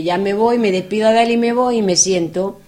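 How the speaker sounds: ordinary delivery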